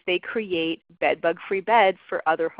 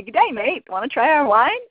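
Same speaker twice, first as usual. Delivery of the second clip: put-on a light, playful Australian accent, higher and louder; mock Australian accent; 7 semitones higher, 6 dB louder than usual. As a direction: ham up a cheery Australian salesman greeting. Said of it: "put on Australian pith helmets and ... say".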